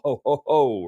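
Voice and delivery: a quick, modest demo of Santa's ho-ho-ho; jovial Santa ho-ho-ho. Shown as a demonstration. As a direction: toss in a light Santa chuckle. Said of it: "is the loud, jovial".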